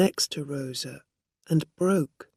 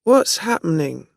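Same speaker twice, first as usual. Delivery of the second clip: put-on drunk man; 3 semitones higher, 9 dB louder than usual. A narrator's reading. Drunk man confused, calling out.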